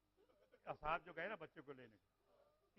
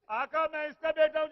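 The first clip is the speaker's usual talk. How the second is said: louder, higher wailing repeat; loud grieving repeat; cried-out answer; 9 semitones higher, 17 dB louder than usual. Acted. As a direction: cry the line out to the mourners. Same answer cried out again.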